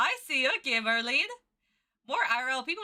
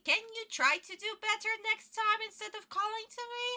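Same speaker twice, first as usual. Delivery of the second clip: put-on high, careful teacher talking to a child; teacher's voice; 10 semitones higher than usual. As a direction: coax a pupil in a high-pitched voice. Acts out teacher correcting the child.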